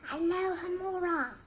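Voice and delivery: soft, high incantation; hushed magic word; whispered spell. A narrator's reading. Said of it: "and whispered".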